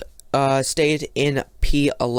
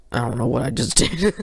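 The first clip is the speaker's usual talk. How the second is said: ordinary talk, nothing marked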